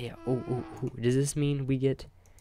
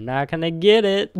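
loud tease; 6 semitones higher, 11 dB louder than usual. Acted. Teasing viewers in game.

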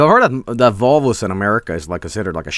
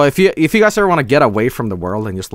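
ordinary delivery

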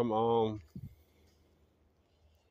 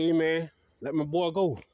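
higher, louder plea; calling out; 6 semitones higher, 4 dB louder than usual. Retold or imitated. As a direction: demand he let his friend go. Pleading loudly with the cop.

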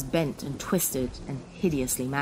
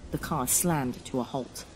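usual speaking voice

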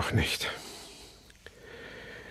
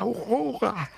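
anguished cry. Acted. Stumbling, calls his dead wife.

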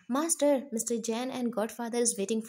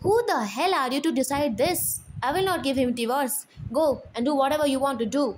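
loud, higher and very fast; furious defiance; 4 semitones higher, 5 dB louder, much faster than usual. Acted. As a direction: throw defiance at the old man. Wife defies dad about divorce.

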